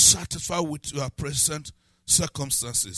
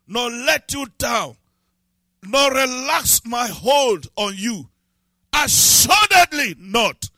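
loud, slow, higher declaration; slow, climbing preacher's roar; preaching shout; 7 semitones higher, 8 dB louder than usual. Real, not acted. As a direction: shout the promise over the congregation. Preaching climax.

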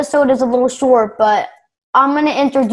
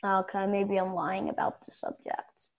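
quiet; 4 semitones lower, 17 dB quieter than usual. Real, not acted. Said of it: "I have to lower my voice".

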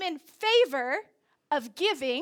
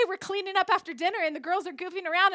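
normal talking voice

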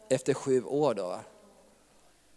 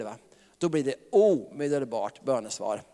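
normal talking voice

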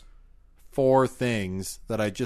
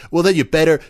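louder, higher outburst; 6 semitones higher, 6 dB louder than usual. Acted. Ad builds to outburst.

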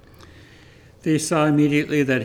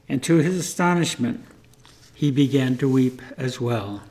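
plain reading voice

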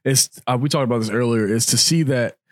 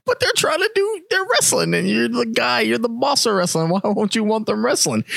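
much higher, lightly voiced puzzled child; confused kid voice; 10 semitones higher than usual. Recalling being a confused kid fan, a higher puzzled voice. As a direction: ask it as a baffled young fan. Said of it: "I was like".

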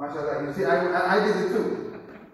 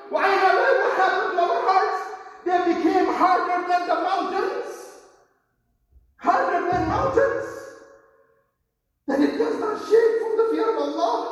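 impassioned sermon peak; preaching at full force; 7 semitones higher, faster than usual. Acted. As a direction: rouse the congregation hard. Preacher climaxes on hard hearts.

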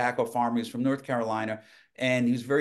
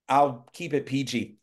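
ordinary delivery

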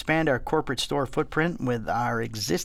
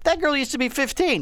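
surprised; 11 semitones higher than usual. Retold or imitated. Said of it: "I'll go".

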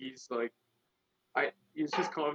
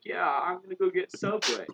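coworker's casual announcement; 6 semitones higher, 5 dB louder than usual. Retold or imitated. Voices predictable coworker announcing lunch.

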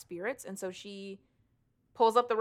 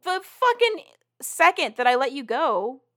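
angry retort; 7 semitones higher, 7 dB louder than usual. Retold or imitated. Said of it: "And she's like".

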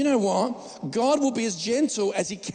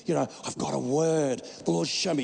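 mock prophet; 5 semitones lower, 4 dB quieter than usual.

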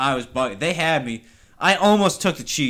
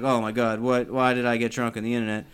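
much lower and quieter, a bit fast; panicked low mutter; 5 semitones lower, 5 dB quieter than usual. Retold or imitated. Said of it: "I was like".

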